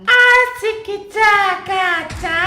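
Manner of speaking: very high and loud falsetto squeal; squeaky falsetto. Shown as a demonstration. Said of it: "bisa gak suara kayak gitu".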